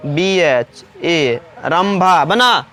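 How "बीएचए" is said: slow spelling. Shown as a demonstration. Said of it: "भ का".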